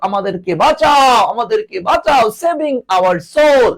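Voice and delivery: high, stretched cries for help; mock plea from a sinking ship; acted distress call. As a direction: wail for rescue, hammed up for class. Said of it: "কঠিন ভয়ঙ্কর বিপদে যখন পড়ে যায়".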